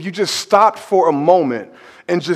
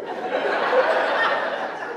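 breathless laugh; 10 dB quieter than usual. Real, not acted. Laughing at the memory.

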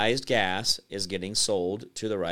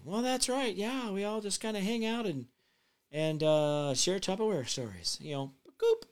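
much higher, softer mock party-lady voice; mock party hostess; 9 semitones higher, 5 dB quieter than usual. Mocking Tupperware party guests in a much higher voice. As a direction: play a gushing Tupperware hostess, high and hushed.

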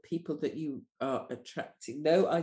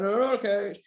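higher, louder retold denial; protesting; 5 semitones higher, 5 dB louder, faster than usual. Doula denying to her friend she's a coach, protesting. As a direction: insist on it, mock indignant.

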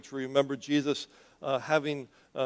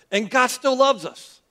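emphatic preaching; 9 semitones higher, 6 dB louder, slower than usual. Real, not acted. Climax of the sermon point.

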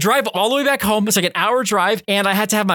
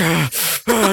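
heavy seething breath; fuming huff. Retold or imitated. Driver looking at the glued window, fuming huff. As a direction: breathe out hard in retold rage. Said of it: "just fuming".

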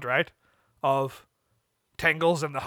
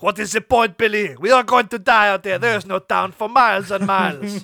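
higher, louder acted rant; despairing outburst; 4 semitones higher, 6 dB louder than usual. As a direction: cry out as if all hope is lost. Honk losing hope, shouting at Billy.

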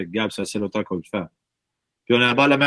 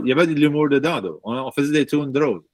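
usual speaking voice

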